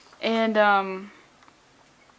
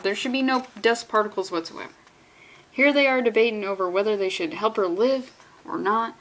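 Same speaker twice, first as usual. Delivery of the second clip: ordinary talk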